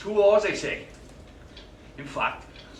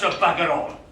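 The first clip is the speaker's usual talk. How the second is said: usual speaking voice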